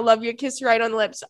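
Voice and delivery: usual speaking voice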